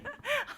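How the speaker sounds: short high laugh. Real, not acted. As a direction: laugh at the silly picture. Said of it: "started laughing out loud".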